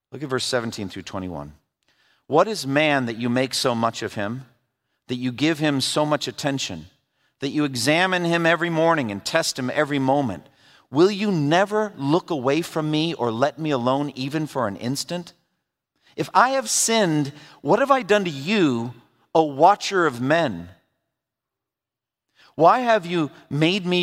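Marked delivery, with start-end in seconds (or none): 2.3-15.3 scripture reading. Acted. Pastor reading Job's complaint to the congregation, earnest scripture reading. 16.2-20.7 higher, pleading cry to God; impassioned plea. Acted. Job crying out to the watcher of men, impassioned high plea. Impassioned reading of Job's question.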